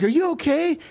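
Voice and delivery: high worried voice; worried girlfriend voice. Retold or imitated. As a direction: ask like an alarmed girlfriend. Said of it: "my girl's like".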